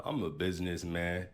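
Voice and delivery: usual speaking voice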